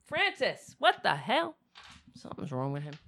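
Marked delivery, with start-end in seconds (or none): 0.0-1.5 alarmed call. Real, not acted. Calling the cat, alarmed.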